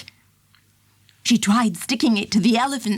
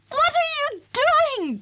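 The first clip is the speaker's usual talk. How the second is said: high alarmed call, much higher. A narrator's reading. Warden calls out as the elephant pulls up the meter. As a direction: call after him in alarm. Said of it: "called Brenda".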